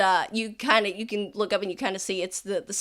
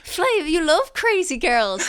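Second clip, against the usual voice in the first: high, laughing taunt; teasing soldier; 8 semitones higher, 5 dB louder than usual. Acted. Distant soldier to Flavian about crazy girls, teasing. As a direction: tease a buddy in a high voice.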